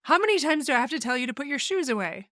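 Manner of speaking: exasperated parent nagging about shoes. Shown as a demonstration. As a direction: whine it like a parent who has said it a hundred times. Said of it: "it might be said like this".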